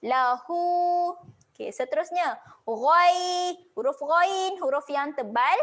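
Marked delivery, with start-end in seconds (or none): 0.1-1.2 "Lahu" with the hu held two beats; long vowel. Shown as a demonstration. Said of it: "kena baca panjang dua harakat". 2.7-4.6 "Ghai" with a thick, full ghain; heavy ghain. Shown as a demonstration. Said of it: "tebalkan bunyi huruf ghain".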